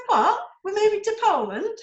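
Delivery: high, loud retold shock; shocked disbelief. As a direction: replay your own stunned reaction. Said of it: "I said".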